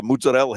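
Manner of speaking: "Mozzarella" as "moots-a-RELL", last vowel dropped; Italian pronunciation. Shown as a demonstration. Reusing the Italian way.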